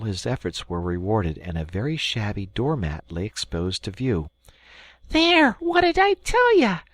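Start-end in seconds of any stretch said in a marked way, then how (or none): 5.1-6.8 high, loud gloating cry; triumphant. Acted. Rat triumphant to Mole over the doormat. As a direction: crow over the find. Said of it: "exclaimed the Rat in great triumph".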